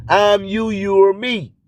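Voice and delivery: ordinary delivery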